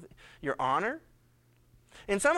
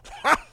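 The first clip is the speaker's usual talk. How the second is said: a loud burst of happy laughter; loud delighted laugh; gleeful laugh; 6 dB louder than usual. Acted. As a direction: laugh like a winner. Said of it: "and he goes".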